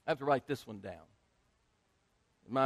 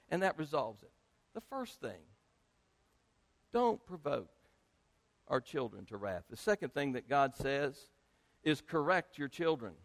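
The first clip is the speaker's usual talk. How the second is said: ordinary talk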